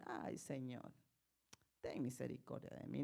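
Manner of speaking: low, soft, subdued plea to the Lord; feeble sick plea. Acted. Sick believer praying to the Lord, low feeble plea. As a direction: plead quietly, as if weakly ill. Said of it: "Cuando usted tiene una enfermedad, le dice".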